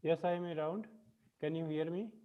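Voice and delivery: barely audible, far from the mic; weak voice, distant from the microphone; faint voice. Real, not acted. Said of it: "Uh, very vague".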